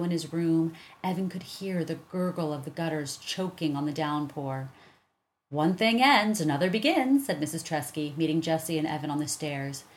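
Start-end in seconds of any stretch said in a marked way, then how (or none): 5.5-7.3 wise philosopher. A narrator's reading. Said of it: "like a wise philosopher".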